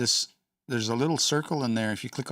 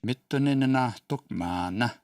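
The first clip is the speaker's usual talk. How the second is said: slow careful demo; slower than usual. Shown as a demonstration. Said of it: "teacher repeats the phrase".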